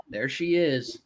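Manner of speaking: usual speaking voice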